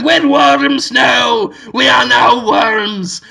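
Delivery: slow wailing chorus of worms; hammed-up, stretched-out worm voice; Brendan Fraser. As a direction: cry it out in drawn-out mock dismay. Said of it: "Brendan Fraser's voice just going".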